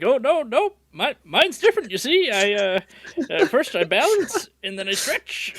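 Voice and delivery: high-pitched hammy backpedalling; put-on high voice, improvising defensively; flustered excuse-making. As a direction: bluff through a made-up explanation. Acting out fighter scrambling to explain.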